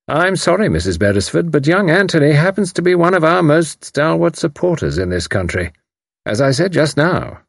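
enjoy revealing the trick; smug commander. Acted. Haydock gloating.

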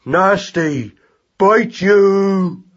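put-on childish, snappish threat; old angry cry. A narrator's reading. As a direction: throw out each word as a crude battle cry. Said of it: "the old cry of".

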